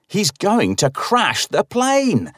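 high, slow desperate scream. A narrator's reading. Narrator screams into the man's face, high and slow. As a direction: stretch the warning out in panic. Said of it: "I scream in his breathless".

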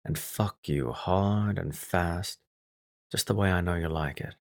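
barely audible, for her ears only; sultry whisper. A narrator's reading. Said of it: "speaking just loud enough for her to hear me".

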